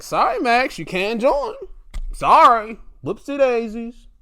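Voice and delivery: high, loud fake sorry; mock apology. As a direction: gloat through an insincere apology. Mock apology to rejected Max.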